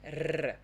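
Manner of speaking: a trilled "R" on its own; vibrating R. Shown as a demonstration. Teacher isolating the sound for students, vibrating R. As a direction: roll the "R" on the tongue tip. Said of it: "this, this vibrating sound".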